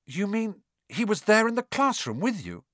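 high, shocked question; gasped. A narrator's reading. Harry realises Voldemort was in class, gasped. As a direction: gasp it in horrified disbelief. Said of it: "Harry gasped".

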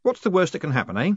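uneasy stab at jollity; trying to sound jovial. A narrator's reading. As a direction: ask it with put-on joviality. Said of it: "trying to sound jovial".

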